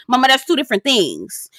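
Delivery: ordinary talk, nothing marked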